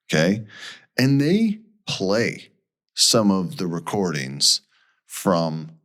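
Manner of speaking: usual speaking voice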